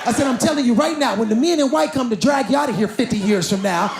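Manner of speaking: ordinary delivery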